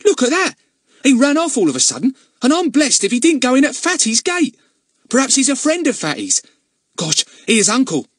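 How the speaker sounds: put-on high, loud excited young lad; excited boy voice. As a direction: blurt it out like an eager boy. Narrator voices Ern.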